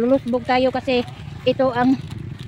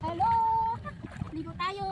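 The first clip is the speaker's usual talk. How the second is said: ordinary delivery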